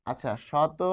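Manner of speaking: usual speaking voice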